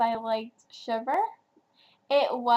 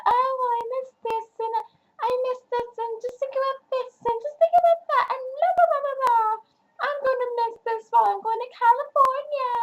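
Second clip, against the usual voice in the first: much higher, far-off sing-song imitation of Isabel; mocking Isabel voice; 13 semitones higher, faster than usual. Reviewer mocks Isabel's wistful monologue, high sing-song voice. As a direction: wave her off with a high blah blah. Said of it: "it's talking about".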